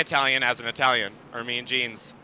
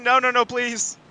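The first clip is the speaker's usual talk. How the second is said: high pleading mock voice; mock driver's plea; voicing Schofield; 12 semitones higher than usual. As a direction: beg like the driver in trouble. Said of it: "Schofield saying".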